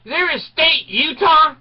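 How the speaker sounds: high, loud, slow calling out; attendant calling to buddy. Retold or imitated. Attendant shouts to his idle buddy, loud slow question. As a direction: holler across the station to his friend. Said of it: "he turns to his buddy and he goes".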